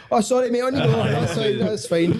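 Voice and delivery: high, hasty climb-down; mock meek apology. Driver spotting a fighter's ears, playfully apologetic. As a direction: back off in a high, placating voice.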